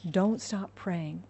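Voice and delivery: ordinary talk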